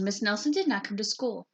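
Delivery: ordinary delivery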